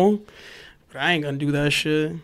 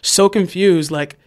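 usual speaking voice

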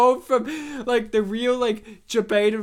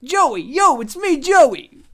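higher, louder, slow New York guy; Joey Tribbiani impression; 6 semitones higher, 7 dB louder, slower than usual. Friend showing off his Joey Tribbiani bit. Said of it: "my Joey Tribbiani impersonation".

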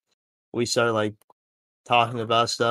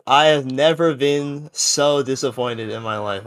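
ordinary delivery

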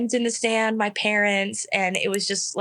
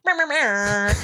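imitated meowing; slower than usual. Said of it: "music going off in the stadium".

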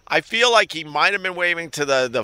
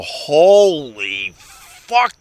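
hugely drawn-out, louder exclamation; acted amazement; 5 dB louder, much slower than usual. Host imagining waking up impressed by a politician, acting amazed. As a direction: stretch it out slowly, as if floored by admiration.

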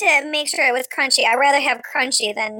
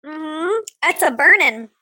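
ordinary delivery

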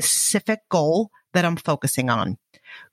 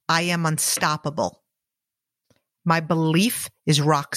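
guided affirmations. Acted. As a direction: state each affirmation steadily for repeating. Recited affirmations for listener to repeat.

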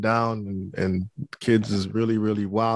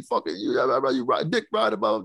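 imitating a raging kid, much higher; hammed-up teenage fury, pitched way up; mock student meltdown; 4 semitones higher than usual. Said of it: "just going nuts".

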